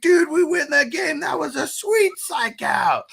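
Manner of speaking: high, loud, excited dude voice; high excited movie voice. Retold or imitated. Friend retelling a comedy scene, high excited shout. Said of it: "he comes over and he's like".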